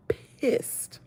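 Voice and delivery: breathy whispered word; whispered fury. Real, not acted. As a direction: let the anger hiss out under your breath. Stating her anger.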